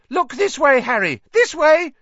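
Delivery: high, loud, eager fan calling out; a star-struck kid yelling for attention; shrill cry. A narrator's reading. Young fan calling to Harry while snapping photos, shrill eager cry. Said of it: "he cried shrilly".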